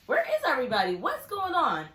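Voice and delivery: loud, high bewildered calling out; confused alarm. Retold or imitated. Kid calling out to an empty house, loud and confused. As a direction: call through the empty house, baffled.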